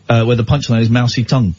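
ordinary talk, nothing marked

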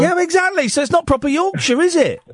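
high-pitched, triumphant protest; mock indignation. Host catching out a West Yorkshire caller, high mock indignation. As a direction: pounce on it with comic outrage.

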